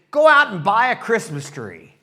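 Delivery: slow, loud commanding call; loud bossy order. Retold or imitated. Kids ordering Charlie Brown to buy a tree, loud and slow. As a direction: boom it out like a bossy kid.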